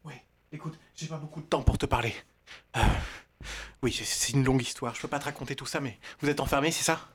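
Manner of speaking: through a vent. Acted. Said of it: "t'es dans le conduit d'aération?".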